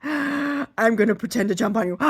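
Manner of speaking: high loud puppy shout; hammed-up bouncing puppy; excited puppy voice. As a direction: yell it like an overjoyed puppy. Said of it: "They'd be like".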